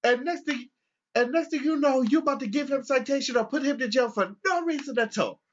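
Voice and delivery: high, loud offended grandpa; the old man challenging the cop, high and loud; indignant old man. Retold or imitated. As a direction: sound scandalised and high. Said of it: "he's just like".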